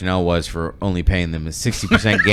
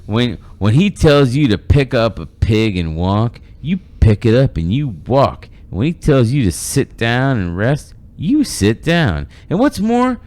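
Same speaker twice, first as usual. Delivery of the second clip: slow, higher Texas drawl with loud swooping stresses; Southern Texas accent; 5 semitones higher, 5 dB louder than usual. Acted. Reading the boss's rough orders to a worker, in a Southern accent. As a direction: lay on a super-Southern, Texan twang. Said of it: "super, like, Southern slash Texas".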